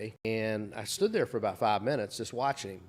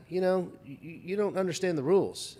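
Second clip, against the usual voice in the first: higher-pitched, stumbling, worked-up retort; getting worked up; 7 semitones higher than usual. Retold or imitated. Security guard scolding a man about the rules, mildly worked up. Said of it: "he was getting worked up".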